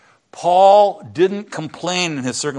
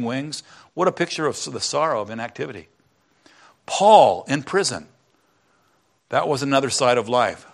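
reading aloud; 4 dB louder than usual. Acted. Reads from Streams in the Desert.

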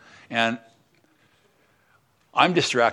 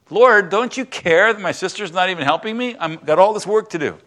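higher, louder exasperated complaining; upset complaint; 8 semitones higher, 5 dB louder than usual. Retold or imitated. Martha complains to Jesus that her sister won't help, exasperated. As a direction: voice Martha's frustrated protest to the Lord. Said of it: "Martha finally got upset".

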